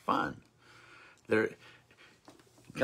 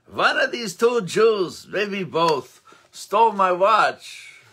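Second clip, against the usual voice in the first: higher, louder accusation, slowing on the last words; accusing shout; 8 semitones higher, 7 dB louder, slower than usual. Acted. Nobleman accusing the two brothers before the judge, shouting. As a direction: bellow it at the judge.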